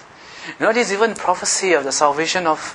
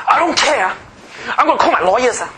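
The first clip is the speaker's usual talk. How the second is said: angry person; 9 semitones higher than usual. Retold or imitated. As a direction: act out a hothead threatening to sue. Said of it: "have you seen one angry person deal with another angry person?".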